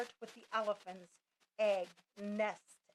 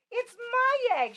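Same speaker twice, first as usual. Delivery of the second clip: high, loud angry sputter; 13 semitones higher, 10 dB louder than usual. A narrator's reading. Maisie sputtering at Horton, furious and loud. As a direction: spit it out in outrage. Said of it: "she sputtered".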